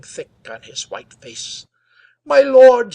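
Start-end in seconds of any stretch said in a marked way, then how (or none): 2.2-2.9 high, loud, hoarse cry. A narrator's reading. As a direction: cry out in panic. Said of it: "he cried hoarsely".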